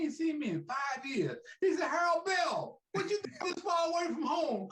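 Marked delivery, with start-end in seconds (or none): 1.9-4.7 high, calling out across the lobby; imitating Ali greeting an old friend. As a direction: call his name, surprised to see him. Ali calls out to him.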